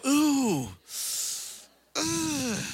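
high mock "ooh, ugh" of distaste; hammed-up disgusted groan. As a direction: groan like the word tastes bad. Humans reacting to God's word.

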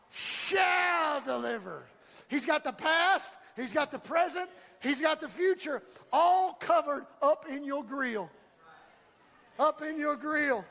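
rising, shouted preacher cadence, punching key words; preaching at full force. Acted. Pastor rallying his church, preaching at full force. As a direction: build the congregation up, voice climbing.

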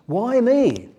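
mildly hammed-up overwhelmed Saul; pleading why me. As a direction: cry out in disbelief. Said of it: "He sounds overwhelmed".

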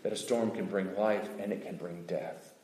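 low, soft, solemn voice of God. Retold or imitated. God questioning Job about the storm, low and solemn. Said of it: "God says".

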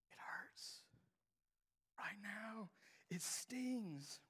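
whispered, fading into a hush; whispered hurt. Real, not acted. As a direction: let the pain come out in a breath. Quiet confession of pain.

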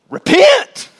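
shouted high and loud; John's shout. Acted. John the Baptist crying out to the crowd, a loud high shout. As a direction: cry it out like a prophet in the desert.

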